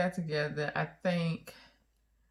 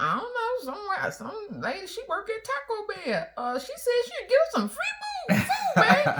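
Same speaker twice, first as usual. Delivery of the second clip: much higher, laughing, casual; innocent husband; 14 semitones higher than usual. Retold or imitated. Wife imitates husband explaining the Taco Bell woman, much higher with a laugh. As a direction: shrug it off, oblivious. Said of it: "Someone said".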